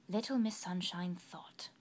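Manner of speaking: usual speaking voice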